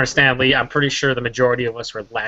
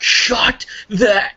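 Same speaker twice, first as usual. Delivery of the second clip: retold yell; 9 semitones higher than usual. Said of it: "at the end you were like".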